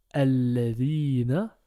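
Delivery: Alladhina drawn out slowly, held double l; slow demonstration. Shown as a demonstration. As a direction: spell out Alladhina for students. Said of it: "notre alif se lit comme un A".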